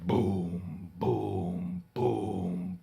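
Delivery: low heavy booms; deep giant booms; booming footsteps. Acted. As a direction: stomp it like giant steps.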